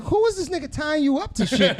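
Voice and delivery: high, laughing mock indignation; laughing disbelief. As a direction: crack up at the absurd text. Mock outraged reply to sext.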